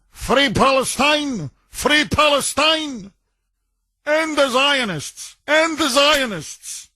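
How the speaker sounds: high, drawn-out mock shouting of slogans; imitated screaming. Host retells an attacker screaming in the park, high mock yell. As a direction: imitate someone yelling slogans, slow and high-pitched. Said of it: "starts screaming".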